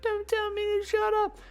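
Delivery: put-on very high, loud kid squeal; squabbling child. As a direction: squeal back like a little kid. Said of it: "and the other kid's like".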